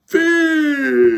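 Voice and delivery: very high, loud shouted answer; punchline shout. Acted. Pirate chief answering the Troll, big shout.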